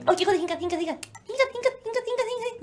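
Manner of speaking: soft, quick repeated coaxing; coaxing a pet. Real, not acted. Vlogger wants Loki in frame, coaxing softly. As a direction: softly urge the animal over here.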